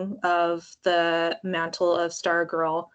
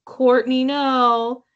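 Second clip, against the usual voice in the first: mock yell; 3 semitones higher, 7 dB louder, much slower than usual. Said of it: "we're constantly yelling".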